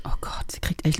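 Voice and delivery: unvoiced conspiratorial aside; whisper. Real, not acted. Telling the listeners about her nervous friend, a whisper.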